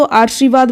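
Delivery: आशीर्वाद said aar-shi-vaad, र moved before श; children's mispronunciation. Shown as a demonstration. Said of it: "कुछ बच्चे यहाँ पर बहुत कन्फ्यूज होते हैं". (आशीर्वाद को आर्शीवाद बोलकर)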